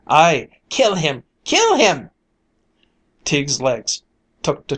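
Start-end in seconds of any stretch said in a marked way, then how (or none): 0.1-2.1 rising into a high, loud shout; crowd shouting. A narrator's reading. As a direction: build the chant to a roar. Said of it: "the hall rang with".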